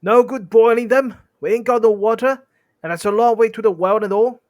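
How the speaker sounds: high, loud troll grumble; loud, high-voiced objection; complaining troll. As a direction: complain loudly, pitched well up. Mystery voice stirs up troll quarrel.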